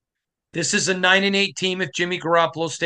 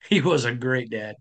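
choked up; 3 semitones lower, faster than usual. Real, not acted. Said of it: "I'm not crying about football".

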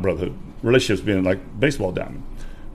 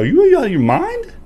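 incredulous; 5 dB louder than usual. Retold or imitated. Friend says tell your wife.

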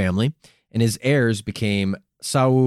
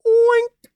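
very high squealed oink; loud, high mock pig noise; 5 dB louder than usual. Host breaking off the history with a high pig oink.

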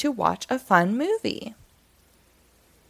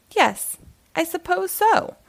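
yawning; 9 semitones higher than usual. Acted. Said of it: "said Hedgehog with a yawn".

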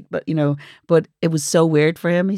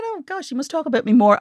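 surprised; 8 semitones higher than usual. Retold or imitated. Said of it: "I said".

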